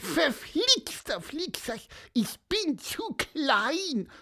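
high, frustrated little bird voice; squeaky. A narrator's reading. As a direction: chirp in frustration, straining. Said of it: "piepste er".

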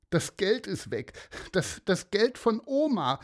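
put-on sobbing boy. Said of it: "schluchzte Aaron".

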